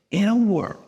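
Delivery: imitating a dramatic trailer intro; movie trailer voice. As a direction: boom it out like the opening of a movie trailer. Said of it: "where she basically goes".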